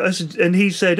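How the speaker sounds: ordinary delivery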